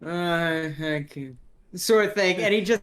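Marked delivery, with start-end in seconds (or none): 0.0-1.4 slow, drowsy drugged mumble; slow doped-up groggy voice; stoned half-asleep. Acted. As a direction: drag the words out, barely awake. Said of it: "he's kinda half asleep".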